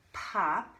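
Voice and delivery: ordinary delivery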